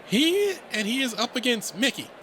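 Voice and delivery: high-pitched rabbit emcee; timid announcer. Acted. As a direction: announce the matchup in a nervous high voice.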